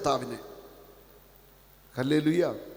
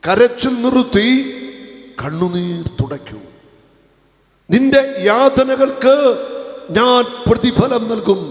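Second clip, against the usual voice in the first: fervent preaching; 7 semitones higher, 10 dB louder than usual. Acted. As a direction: proclaim God's promise. Preaching at full force. (ആവേശത്തോടെയുള്ള പ്രസംഗം)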